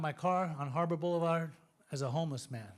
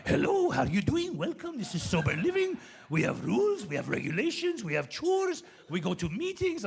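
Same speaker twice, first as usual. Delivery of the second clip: put-on comic Persian accent with swooping pitch; hammed-up little sage with a Persian accent; Yoda; 4 dB louder than usual. Sober living owner greeting a newcomer, Yoda-like Persian accent. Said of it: "sounded like Yoda with a Persian accent".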